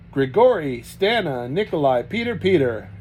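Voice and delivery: loud calls across the yard; bellowing names. A narrator's reading. Sergei calling the family into the snow, bellowing. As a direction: bellow them out of the house. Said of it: "he bellowed".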